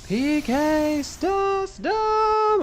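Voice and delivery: high, very slow drawn-out battle cry; hammed-up high PK Starstorm callout; attack call. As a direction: stretch it out like a special-move shout. Calling out a Smash Bros attack.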